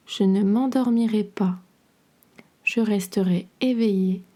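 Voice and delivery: ordinary talk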